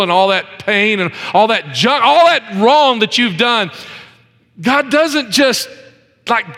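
high, building sermon climax; impassioned pulpit voice, stretched key phrases; fervent preaching. Real, not acted. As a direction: preach it with mounting urgency and conviction. Preaches the moral at full force.

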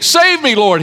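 high, urgent cry for rescue; shouting for help. A narrator's reading. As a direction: call out like a sinking man grabbing for help. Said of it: "he shouted".

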